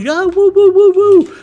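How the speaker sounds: high, loud whoops of glee; giddy investor woo-hoo; excited whooping. Retold or imitated. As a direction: cheer like the stock is soaring. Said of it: "you're getting excited".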